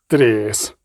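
низький, неголосний окрик старого наставника; гукни коротко й низько, щоб урвати; стриманий окрик. A narrator's reading. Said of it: "крикнув Весемір". (помірний окрик)

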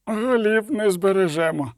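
usual speaking voice

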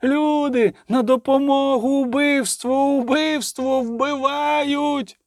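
high, sustained alarm calls; crying out. A narrator's reading. Someone between houses calls for help, crying out. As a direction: cry murder high, dragging out the vowels. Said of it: "закричав хтось між будинками".

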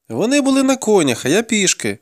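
high-pitched denial; pleading captive boy. A narrator's reading. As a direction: protest in a high boy's voice. Captive boy justifying himself.

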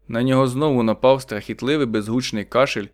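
usual speaking voice

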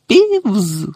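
high-pitched cry of a name; caretaker's cry. A narrator's reading. Caretaker hunting the poltergeist at night, crying out. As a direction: call out sharply, pitched high. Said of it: "крик сторожа Філча".

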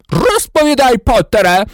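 loud, high-pitched demand; furious yell. A narrator's reading. Snape demanding answers from Potter, yelling. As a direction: yell it like an enraged interrogator. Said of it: "зарепетував він".

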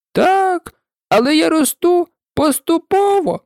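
a tiny creature squeezed and grumbling; Dormouse voice. A narrator's reading. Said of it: "сказав вовчок".

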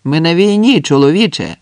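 loud rebuke, starting high; acted soldier's yell; shouted retort. A narrator's reading. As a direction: shout him down, dropping on the last word. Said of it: "крикнув хтось".

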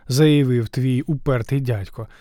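usual speaking voice

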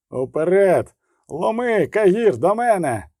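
rally your men in the heat of battle; battle shout. Acted. Geralt rallies men in battle. (бойовий заклик, вище й голосніше)

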